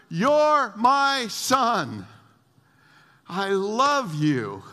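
loud, slow, high proclamation; big drawn-out declaration of fatherly love; voice from heaven. Acted. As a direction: proclaim it from heaven, stretching each word. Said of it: "a voice came from heaven".

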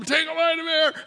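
high-pitched mimicked bellow; megaphone yell. Dad dunking his little boy, imitated megaphone yell. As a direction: imitate a big coach calling out an order. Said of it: "like, megaphone voice".

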